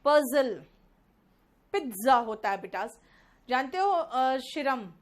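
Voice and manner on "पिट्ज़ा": पिज्जा as PIT-za; louder पिज्जा with a t before the z; correct pronunciation. Shown as a demonstration. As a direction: model पिज्जा with a crisp ts sound. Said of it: "pronunciation थोड़ा अलग निकलता है".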